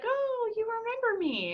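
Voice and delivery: delighted. Retold or imitated. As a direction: squeal with happy surprise. Imitating delighted past client.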